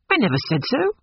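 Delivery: high-pitched protest; crying out. A narrator's reading. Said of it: "he cried".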